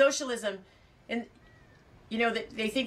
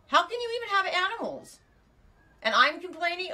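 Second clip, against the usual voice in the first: incredulous; 10 semitones higher, 3 dB louder than usual. Real, not acted. Reacting to viewer's huge bill.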